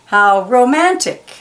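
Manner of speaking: plain reading voice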